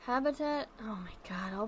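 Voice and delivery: a hushed mutter under the breath; whispered aside. Real, not acted. Streamer whispering to herself over a game warning.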